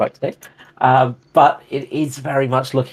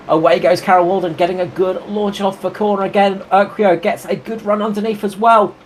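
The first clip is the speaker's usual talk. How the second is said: raised, lively race call; motorsport commentator; 6 semitones higher than usual. Acted. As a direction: call the restart with energy. Calls the race restart.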